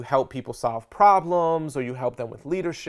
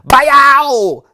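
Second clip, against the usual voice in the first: excited yelp; 12 semitones higher, 16 dB louder than usual. Real, not acted. High five clap.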